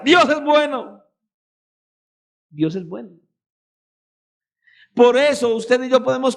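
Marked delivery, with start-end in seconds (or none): 0.0-1.0 proclaimed. Real, not acted. Preacher proclaims, then repeats softly. 2.5-3.1 "Dios es bueno" repeated lower and softer; soft echo. Real, not acted.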